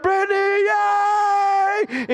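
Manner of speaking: high, drawn-out mock cheer; retold cheering yell. Parents cheering their daughter at graduation, re-enacted yell. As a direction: cheer the name out, holding the "yay". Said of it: "then we yelled out".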